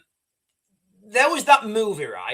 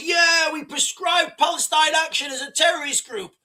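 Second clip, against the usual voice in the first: higher-pitched mock crowd cheer; 7 semitones higher than usual. Host mimicking people pleased about the terror ban, mock cheering. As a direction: imitate people hailing a victory.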